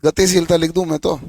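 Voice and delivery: ordinary talk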